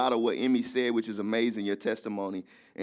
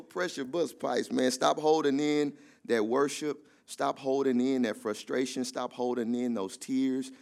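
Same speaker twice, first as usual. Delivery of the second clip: normal talking voice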